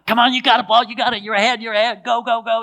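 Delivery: a high, loud imitated cheering yell; cheerleader screaming. Roommate cheers him on each lap, high loud imitated screaming. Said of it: "He was screaming his head off".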